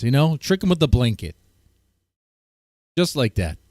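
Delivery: usual speaking voice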